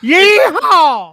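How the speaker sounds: high, loud Texan whoop; hammed-up Texas yeehaw; mock cowboy yell. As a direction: holler it like a rodeo cowboy. Mocking Texan pronunciation.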